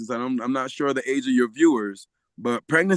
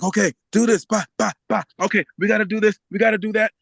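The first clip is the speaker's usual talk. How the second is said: lightly imitated midwife giving orders, pitched higher; take-charge midwife; 6 semitones higher, faster than usual. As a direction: rattle off instructions in a raised voice. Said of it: "the way she acted".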